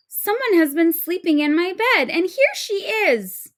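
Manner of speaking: put-on baby octopus discovers the mermaid in her bed; surprised baby octopus. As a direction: squeal it like a tiny child finding a stranger.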